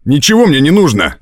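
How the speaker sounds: high, irritated outburst; angry rebuff. A narrator's reading. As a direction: snap at her to back off, pitched high. Man angrily pushes girl away.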